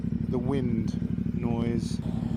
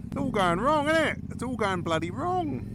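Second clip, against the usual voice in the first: high-pitched mock wail; mock despair; 11 semitones higher than usual. Real, not acted. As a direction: whine it, hammed-up dismay. Camera wobbling, mock despair.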